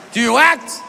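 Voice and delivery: high, punchy barked question; hammed-up movie star, punching the last word; Al Pacino. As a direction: fire the question out with a jump in pitch. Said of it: "Al Pacino says".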